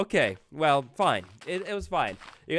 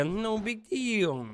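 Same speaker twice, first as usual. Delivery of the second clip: high, very slow no big deal; drawn-out nervous; 8 semitones higher, much slower than usual. Real, not acted. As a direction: stretch it out, nervously. Nervous self-reassurance.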